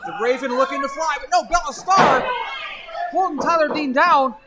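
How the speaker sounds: rising excited play-by-play; wrestling commentator. Acted. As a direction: call the big spot, building up. Live play-by-play climax.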